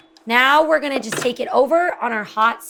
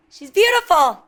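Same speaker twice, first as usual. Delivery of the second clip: "She's beautiful" louder and higher, falling on "beautiful"; excited praise; 5 semitones higher, 5 dB louder than usual. Real, not acted. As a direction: burst out with "beautiful" in delight. Admiring bread, repeated louder.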